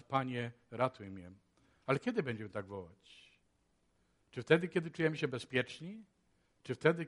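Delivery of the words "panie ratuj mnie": quiet plea. Retold or imitated. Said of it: "w ten sposób możemy wołać".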